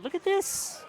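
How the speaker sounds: high, delighted squeal; excited exclaim. Real, not acted. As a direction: shoot up in pitch with delight. Excited at father-son duo.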